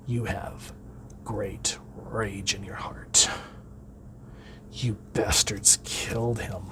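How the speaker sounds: slow, unvoiced rasping whisper from an alien ring; sinister ring voice. The Red Lantern ring welcomes grieving Guy, rasping whisper. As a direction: breathe it out like a menacing power ring.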